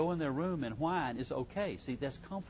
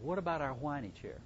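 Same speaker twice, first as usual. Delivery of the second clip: ordinary talk, nothing marked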